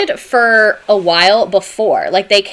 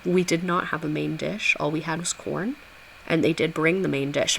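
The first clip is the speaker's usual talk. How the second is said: soft, lowered concession; mock reluctant admission; 4 semitones lower, 10 dB quieter than usual. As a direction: concede it quietly, as the colonists would. Mock colonists' grudging admission before "But.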